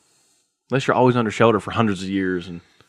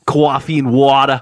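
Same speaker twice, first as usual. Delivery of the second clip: Jersey accent; 2 semitones higher, 6 dB louder, slower than usual. Shown as a demonstration. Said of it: "Jersey has a different twang".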